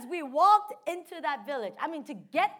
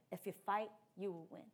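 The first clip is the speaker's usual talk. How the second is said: inner voice; 5 semitones lower, 10 dB quieter, faster than usual. Retold or imitated. Repeated inner word.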